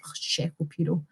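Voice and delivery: low, very soft aside; retold muted reaction. Streamer recalling Shapiro blocking her on Twitter, muted reaction. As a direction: drop right down, almost under the breath.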